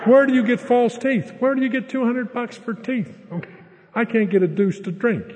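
fear setting in. Retold or imitated. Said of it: "Now the fear sets in".

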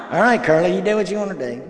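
put-on fine, have it your way, kid; exasperated grandpa caving in; resigned giving in.

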